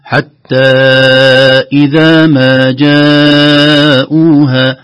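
"إذا ما جاءوها" chanted very slowly, the long vowels held; drawn-out madd. Shown as a demonstration. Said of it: "علامة مد تلاحظون". (مد طويل في "إذا ما جاءوها")

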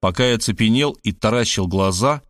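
usual speaking voice

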